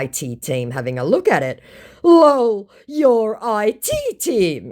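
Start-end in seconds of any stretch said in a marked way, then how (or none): 2.0-4.7 higher, louder, slow mockery; mocking. Scammer mocks the narrator's team, louder and higher. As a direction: sneer at "your I T team". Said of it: "he mocks".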